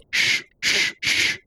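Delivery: repeated unvoiced shushes; hushing. A narrator's reading. Man hushes his friends, shushing. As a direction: shush them in separate hisses.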